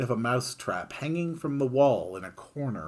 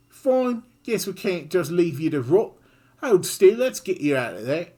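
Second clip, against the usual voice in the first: high, loud, grudging; Kid's grudging voice; 8 semitones higher, 7 dB louder than usual. Acted. Kid freeing the mouse, loud and grudging. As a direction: agree with a sigh but firmly.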